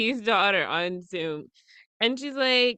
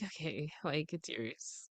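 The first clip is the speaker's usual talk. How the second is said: much softer, giving up on the drink; resigned murmur; 7 semitones lower, 16 dB quieter, slower than usual. Retold or imitated. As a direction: let it go with a defeated hush. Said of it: "I'm like".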